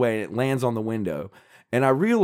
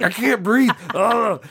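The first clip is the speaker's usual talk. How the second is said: mock gasping; 8 semitones higher, slower than usual. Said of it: "I start choking".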